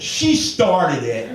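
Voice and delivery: put-on high, drawn-out childish protest; defensive. Man facing fifty staring shoppers, defensive. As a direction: whine the blame onto her.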